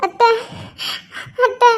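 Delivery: sobbing between the words; crying. Acted. Crying daughter-in-law calls to her aunt, sobbing. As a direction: call out through sobs. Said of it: "எதுக்கு இப்ப அழுதுட்டு இருக்க".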